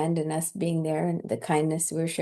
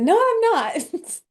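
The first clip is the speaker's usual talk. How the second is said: much higher, slow, insistent denial; emphatic no; 12 semitones higher, 5 dB louder, slower than usual. Retold or imitated. Telling the man she is no angel, high emphatic denial. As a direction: stretch it out high as you wave it away. Refusing being called an angel.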